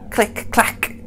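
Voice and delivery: clatter it like bones; clicking skeletons. A narrator's reading. Skeletons chasing the monsters, breathy clicking bones. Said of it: "go the skeletons".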